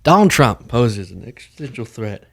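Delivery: stiff, halting mock speech; badly delivered speech, played for laughs; mock politician reading. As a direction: lightly imitate a senator reading a statement. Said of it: "if I came to you during this show".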